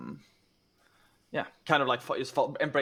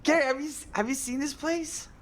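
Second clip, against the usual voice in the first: very high, excited marveling; giddy amazement; 13 semitones higher than usual. Acted. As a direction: gush to your brother, pitch way up, stretching the end. Ace shows brother the cave.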